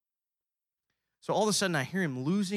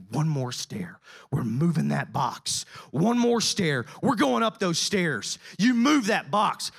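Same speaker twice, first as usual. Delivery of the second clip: slow, rising to louder, higher preaching; building preaching; 5 semitones higher, 7 dB louder, slower than usual. Acted. A preacher tells the congregation God is urging them on, building to a higher, louder pulpit call. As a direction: drive it home like a pulpit climax.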